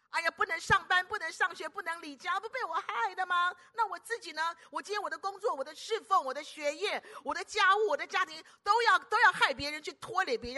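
guilty panic. Acted. Preacher voices guilty panic.

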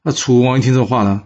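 usual speaking voice

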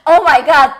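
loud high oh my god; dismay. Shown as a demonstration. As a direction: cry out in shock. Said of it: "哦，我的老天爷啊".

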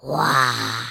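growling roar like a dinosaur. Acted. Kid showing dinosaur roar. (学恐龙吼叫)

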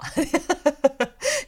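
尖亮上扬的笑声; 自嘲地大笑; 高声大笑. Real, not acted. 自嘲先摆再看风水. (高声大笑)

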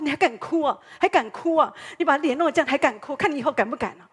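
loud, fast scolding; a father scolding his crying son while wiping his face; angry father scolding. Retold or imitated. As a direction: rebuke a crying child, loud and quick. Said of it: "我爸说".

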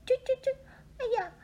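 very high, crying little bird; whimpering bird. Acted. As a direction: whine like an overstuffed bird. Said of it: "鸟说".

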